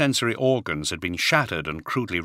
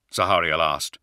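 ordinary delivery, nothing marked

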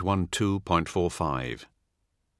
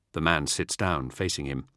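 ordinary delivery, nothing marked

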